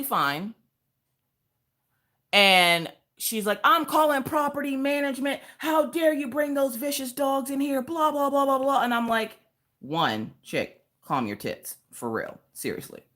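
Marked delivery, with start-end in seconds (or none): 3.6-8.8 high-pitched imitation of an outraged lady; mock yelling. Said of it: "She's yelling at me". 9.8-13.0 low, soft, deliberate put-down; deadpan retort. Retold or imitated. Retorting to the upset lady.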